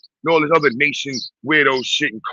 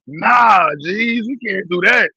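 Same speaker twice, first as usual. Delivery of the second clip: high, loud shouted nah, then slower; outraged no; 6 semitones higher, 3 dB louder, slower than usual. Real, not acted. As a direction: shut the idea down hard. Rejecting avocado on turkey bacon.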